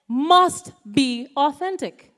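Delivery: slow, weighty Must be authentic; slow emphatic. Shown as a demonstration. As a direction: stress must, slowly. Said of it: "repeats the point".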